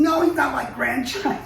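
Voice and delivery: loud, high mock yell of protest; high-pitched indignant shout; retold yell. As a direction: yell it back in indignant denial. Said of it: "I yelled at him".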